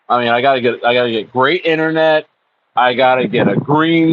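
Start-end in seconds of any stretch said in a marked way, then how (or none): none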